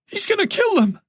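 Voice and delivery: high frantic fear; panicked. Acted. Tess telling Moran her parents are in danger, panicked high voice.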